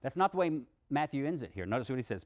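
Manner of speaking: ordinary delivery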